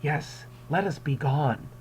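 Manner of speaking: sinister calm. Retold or imitated. Teacher voicing Montresor, slow and cold. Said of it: "And he goes".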